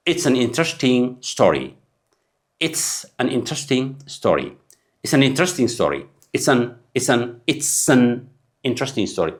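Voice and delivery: very slow, "an" reduced; slow drill. Shown as a demonstration. Teacher drilling the phrase for learners. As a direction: draw it out for learners. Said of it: "teaching demo of an".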